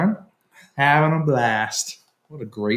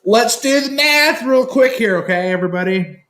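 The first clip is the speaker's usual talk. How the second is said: excited; 11 semitones higher, 3 dB louder than usual. Real, not acted. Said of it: "addressing everybody".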